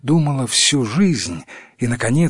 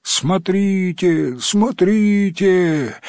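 very drawn-out, raised calls; villagers calling out; 6 semitones higher, much slower than usual. A narrator's reading. Third village spots the frog, long raised calling. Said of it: "кричали в третьей деревне".

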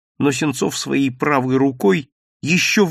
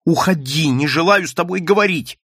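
angry; 5 semitones higher, 3 dB louder than usual. Acted. Nadya drives the man out.

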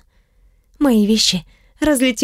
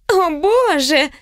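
shocked squeal; 4 semitones higher, much slower than usual. A narrator's reading. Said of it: "завизжали подружки".

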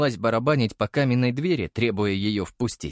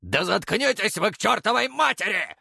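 raised, distant furious holler; angry yell; 10 semitones higher than usual. Acted. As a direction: bellow in irritation from afar. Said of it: "злой вопль Огнёва".

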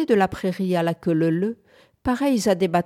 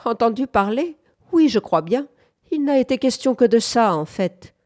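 lightly voiced old grumbler, higher and louder; grumbling old man; 6 semitones higher, 5 dB louder than usual. A narrator's reading. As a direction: grumble back as a mocking old fellow. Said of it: "grommela le bonhomme".

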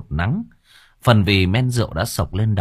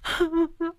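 wailing sob; 6 dB quieter than usual. Acted. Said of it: "khóc bù lô bù loa".

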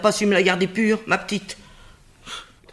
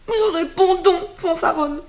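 higher, louder, slow demand; shrill scolding; 9 semitones higher, 4 dB louder than usual. Acted. Nurse scolds Antigone, demanding an answer, higher and louder. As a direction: force her to answer.

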